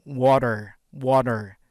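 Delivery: water with a flapped d and a final r, wodder; loud American water; American accent. Shown as a demonstration. Teacher contrasts for students, American accent.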